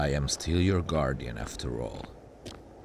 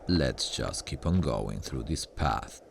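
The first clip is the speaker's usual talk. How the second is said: normal talking voice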